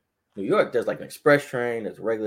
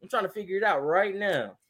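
higher, breezy pretend calm on the phone; fake casual; 7 semitones higher than usual. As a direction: cover up being lost. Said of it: "So, like".

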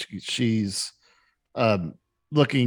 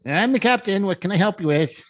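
higher, brisk ship captain greeting visitors; captain's voice; 8 semitones higher, faster than usual. Acted. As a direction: step up as the captain on his deck.

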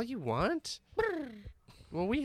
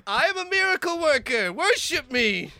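loud, higher royal boasting; grand boast; 8 semitones higher, 8 dB louder than usual. Acted. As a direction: proclaim like a vain king.

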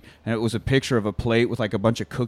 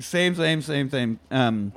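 normal talking voice

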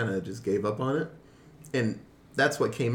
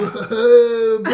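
high loud surfer dude voice; hammed-up stoner surfer drawl; 10 semitones higher, 11 dB louder than usual. Surfer dude stereotype, laughing.

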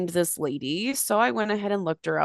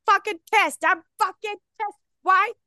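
mock furious rant; 14 semitones higher than usual. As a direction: yell at the TV like an angry fan. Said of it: "I was the angry man throwing my hat".

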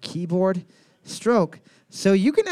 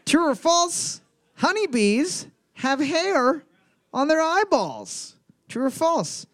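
high, slow quiz question; quiz-host read; 8 semitones higher, slower than usual. Acted. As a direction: read it out like a game-show host.

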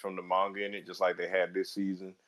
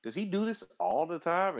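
incredulous; 10 semitones higher than usual. Retold or imitated. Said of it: "I was like".

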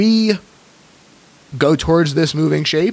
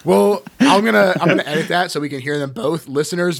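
ordinary talk, nothing marked

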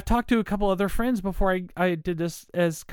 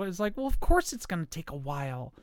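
ordinary delivery

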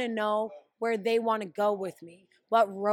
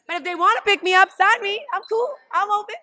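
excited; 9 semitones higher, 6 dB louder, faster than usual. Real, not acted. Eager to be signed.

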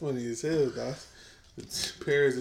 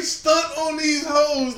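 mock shout; 9 semitones higher, 4 dB louder, much slower than usual. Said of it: "It's like".

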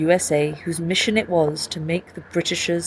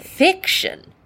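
spat out much higher with scorn; disgusted; 13 semitones higher than usual. Acted. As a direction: sneer at the word Fiction like it's dirty. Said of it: "with the full-blooded disgust".

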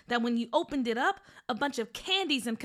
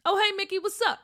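put-on casual kid voice; 7 semitones higher, 4 dB louder, faster than usual. Boy character greeting casually.

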